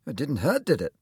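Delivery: slightly anxious, lifted question; mild anxiety. Acted. Surgeon after shaking hands, mildly anxious. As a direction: check with a touch of worry. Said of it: "Mister McCulloch asked anxiously".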